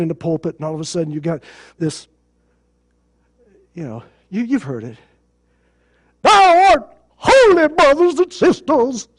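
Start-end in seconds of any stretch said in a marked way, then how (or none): 6.2-9.1 high, slow put-on preacher voice; mock holy-tone preacher, drawn out; preacher's voice. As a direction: ham up a pompous pulpit tone. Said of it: "a preacher's voice".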